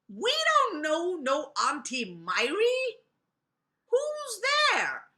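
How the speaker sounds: put-on high, loud little-kid voice; suspicious kids. A narrator's reading. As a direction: challenge the stranger through the door, pitched high. Kids challenge voice at door.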